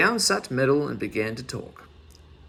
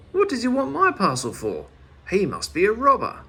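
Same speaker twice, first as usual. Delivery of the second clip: high, louder worried voice; alarmed; 7 semitones higher, 4 dB louder than usual. A narrator's reading. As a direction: fret aloud in alarm. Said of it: "quite alarmed".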